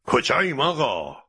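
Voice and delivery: indignant, high-pitched burst; angry outburst. A narrator's reading. Captain exploding at Mr. Fogg on deck, angry outburst. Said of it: "مثل بمب منفجر شد و گفت".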